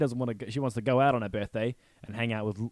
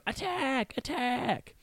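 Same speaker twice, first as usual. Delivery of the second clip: high, squeaky bee battle cry; small, very high mock war cry; tiny bee voices; 13 semitones higher, 4 dB quieter, much slower than usual. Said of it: "hear them go".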